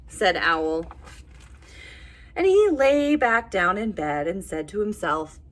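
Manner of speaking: ordinary delivery, nothing marked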